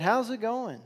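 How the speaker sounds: loud, high call across the water; calling out from shore. Acted. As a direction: shout it out to the boat. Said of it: "calls out".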